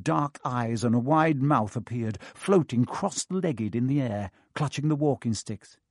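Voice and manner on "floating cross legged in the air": plain reading voice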